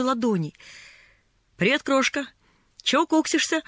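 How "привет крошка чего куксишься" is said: put-on cheery higher-pitched boy's greeting; cheerful. A narrator's reading. Newsboy greets a crying lost girl, cheerful. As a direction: hail a pouting kid cheerfully. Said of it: "весёлый голос".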